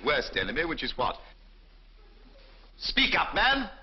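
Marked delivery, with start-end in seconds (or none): 2.8-3.7 impatient bark. Acted. Surgeon to student, an impatient raised bark. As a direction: bark it at a tongue-tied student.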